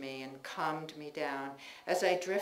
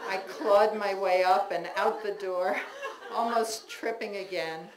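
ordinary delivery

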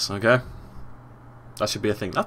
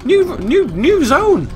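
very high, excited exclaiming; thrilled discovery; 13 semitones higher than usual. Real, not acted. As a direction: burst out in amazed delight, pitch way up. Thrilled by the new stage.

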